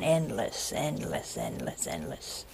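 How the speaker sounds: fading echo of endless; fading repetition. A narrator's reading. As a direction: let the word die away into the distance. Describing an endless horizon.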